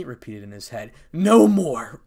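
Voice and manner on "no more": loud, high exclamation; forceful cry. A narrator's reading. Issei packing his backpack, loud and resolute. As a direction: burst out with it, not hushed. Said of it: "it whispered Issei".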